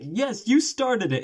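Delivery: high, slow mock accusation; accusing daughter. Daughter accusing the hotel owner, high and slow. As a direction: drag it out like a sulky child. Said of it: "And he was like".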